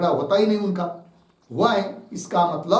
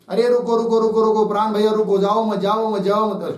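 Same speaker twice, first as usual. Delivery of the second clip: urgent pleading; 2 semitones higher, 4 dB louder than usual. Acted. Senses beg brother Prana not to go, urgent pleading. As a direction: stop someone from leaving, words tumbling out raised.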